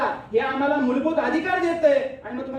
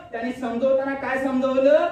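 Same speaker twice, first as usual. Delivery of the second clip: usual speaking voice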